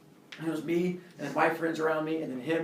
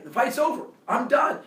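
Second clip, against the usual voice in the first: imitated insistent yell; 7 semitones higher, 4 dB louder than usual. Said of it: "he keeps yelling".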